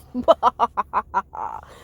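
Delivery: scheming villain chuckle; evil cackle. Acted. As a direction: laugh like a plotting villain. Villain laugh continues.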